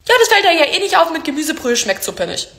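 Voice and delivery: dismissive relative. Retold or imitated. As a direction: blurt it carelessly, as if it's obvious.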